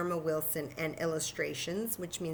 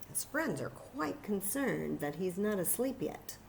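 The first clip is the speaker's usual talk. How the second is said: usual speaking voice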